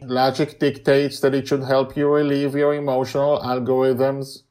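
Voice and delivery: loud, level robotic voice; steady logical machine. Acted. Mastermind speaks.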